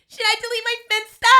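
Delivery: high loud whiny plea; mock whiny question. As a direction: ham up the whiny ask. Mimicking friend crying to uncle.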